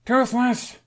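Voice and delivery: loud, high call across the grotto. A narrator's reading. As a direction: call the dragon's name out. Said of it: "he called".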